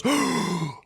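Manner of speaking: loud high theatrical gasp; mock group gasp. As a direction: gasp for a whole shocked room. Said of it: "everybody in the room goes".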